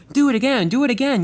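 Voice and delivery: high, loud gleeful yell. Acted. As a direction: egg him on with a shout. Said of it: "yelled one of the freight cars".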